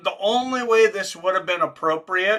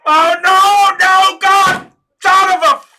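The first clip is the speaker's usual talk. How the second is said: anguished; 13 dB louder, slower than usual. Real, not acted. Reacting in horror to injury.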